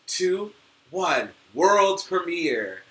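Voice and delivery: slow, loud announcer countdown; TV premiere announcer. Pretend. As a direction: hype it like an MTV premiere. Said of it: "triggered my brain to go to, like, M T V".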